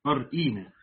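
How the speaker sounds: أرئينا with the hamza sounded and a long ee; original form with hamza. Shown as a demonstration. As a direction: pronounce أرئينا in full, hamza after the ra. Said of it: "وأصلها أرئينا".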